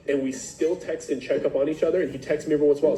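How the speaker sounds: normal talking voice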